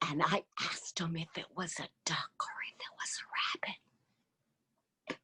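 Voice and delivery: hushed whisper, voice dropped away. Real, not acted. Whispering a secret to the audience about Tom.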